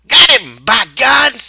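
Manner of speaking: triumphant Curly. A narrator's reading. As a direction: gloat loudly over the kill.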